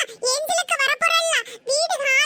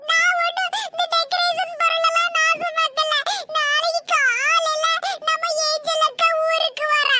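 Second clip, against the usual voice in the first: tearful wail; 3 semitones higher than usual. Acted. Child protesting to mother after being hit, crying. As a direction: whine it through sobs, stretching the words. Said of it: "அழுதுட்டு இருக்கா".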